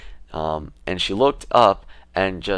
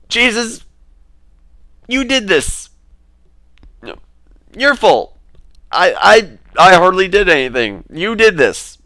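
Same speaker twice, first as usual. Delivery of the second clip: very high, loud mock protest; mock exclaiming; 13 semitones higher, 10 dB louder than usual. Quoting a man crediting Jesus, in high, loud mock exclamation. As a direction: imitate someone crying out, voice pitched way up.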